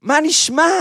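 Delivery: high, loud mock greeting yell; retold shout. Joker yelling again at the giant after the second slap. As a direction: call the name out like spotting a pal. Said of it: "והוא צועק".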